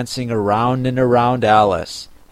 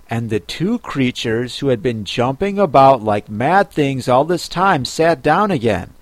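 ordinary delivery

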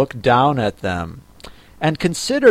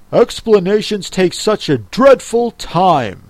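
higher, emphatic complaint; impatient Griffin; 6 semitones higher than usual. Acted. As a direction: complain with exasperation. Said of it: "in an impatient tone".

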